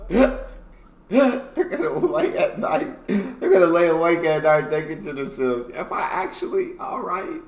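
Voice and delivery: high, laughing, cracking up while quoting; laughing repeat. Real, not acted. Listener repeating the joke about lying awake, laughing. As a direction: repeat it through helpless laughter.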